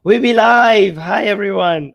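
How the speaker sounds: loud hoarse announcement; sick voice yell. Real, not acted. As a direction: shout it out despite a sore throat. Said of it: "yell, I can't".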